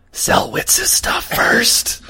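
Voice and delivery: stage whisper. Acted. Player slips the shopkeeper a coin, stage whispering. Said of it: "I whisper to her".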